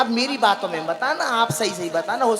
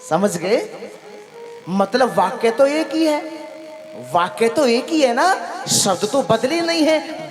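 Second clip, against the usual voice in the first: ordinary talk, nothing marked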